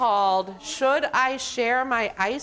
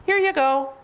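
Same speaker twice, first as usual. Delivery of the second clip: penguin vendor; 6 semitones higher, 4 dB louder than usual. Acted. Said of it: "And penguin says".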